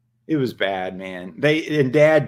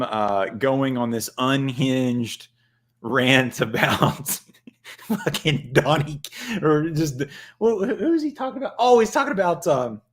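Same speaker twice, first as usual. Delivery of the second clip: ordinary delivery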